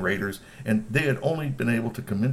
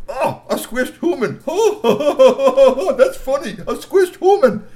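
high, loud laughing delivery; 10 dB louder, much slower than usual. A narrator's reading. Storyteller amused by troll humor, laughing high. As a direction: crack up at the squished human.

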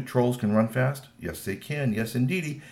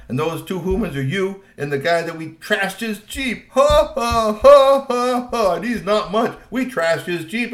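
higher and louder, jeering with cackling laughs; mocking taunt; 7 semitones higher, 7 dB louder than usual. Troll jeering at the human, cackling with scorn. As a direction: laugh right in his face. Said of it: "He stood up and he said".

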